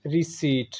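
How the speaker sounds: रिसीट with a silent p, ri-seet; रिसीट ending in seet; correct pronunciation. Shown as a demonstration. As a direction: say रिसीट without the p. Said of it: "सही है".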